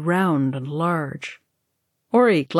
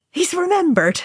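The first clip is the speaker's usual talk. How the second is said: relieved; 12 semitones higher than usual. A narrator's reading. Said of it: "Merry said in relief".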